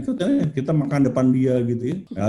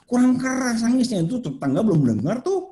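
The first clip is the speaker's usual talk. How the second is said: high, teasing mock complaint; acted demand for more; goading; 7 semitones higher than usual. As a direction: goad them in a raised pitch. Voicing himself goading crying child.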